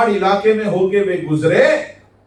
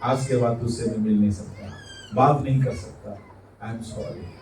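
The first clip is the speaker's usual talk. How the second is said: quiet brush-off; 6 semitones lower, 8 dB quieter than usual. Retold or imitated. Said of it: "उसे कहेगा".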